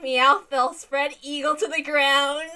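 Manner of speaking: usual speaking voice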